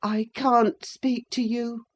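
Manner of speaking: lightly voiced high, ailing boy; feeble murmur. A narrator's reading. Linton refuses to answer his cousin, mild high murmur. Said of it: "he murmured".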